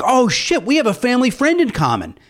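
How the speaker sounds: sudden realization. Retold or imitated. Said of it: "I was like".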